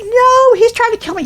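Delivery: high, loud panicked protest; hammed-up desperate denial. Wife denies it to EMTs.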